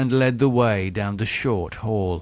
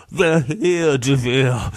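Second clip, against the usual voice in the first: high, plaintive whimper; piteous; 4 semitones higher than usual. A narrator's reading. Haunted victim telling his attacker about the voices, piteous. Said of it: "said Charles piteously".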